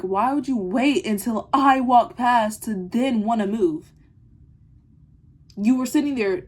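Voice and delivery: annoyed confrontation. Retold or imitated. Confronting the classmate who waited to move, slow and loud. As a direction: call her out, slowing down to make the point. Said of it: "and I'm like".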